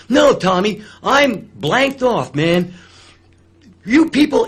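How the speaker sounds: hammed-up outraged owner stopping the show; angry club owner. Owner jumps on stage to stop the dancing, furious shout. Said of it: "he talked like this".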